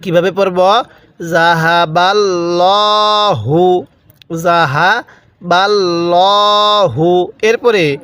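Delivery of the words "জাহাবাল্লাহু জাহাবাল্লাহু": ল্লা in জাহাবাল্লাহু said full and heavy; teacher models the heavy lam of Allah. Shown as a demonstration. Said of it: "এখানে মোটা করে পড়ব".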